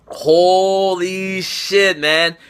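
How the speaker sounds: stretched slowly in disbelief; long amazed exclamation; drawn-out holy shit. Real, not acted. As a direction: let it hang, stunned. Stunned reaction to big upset.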